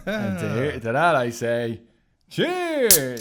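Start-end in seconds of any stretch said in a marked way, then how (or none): 2.3-3.2 mock cheers. Sarcastic toast.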